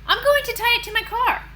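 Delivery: high, loud and fast; eager dentist. Acted. Dentist tells Andrew his plan, fast and eager. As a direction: rattle it off proudly.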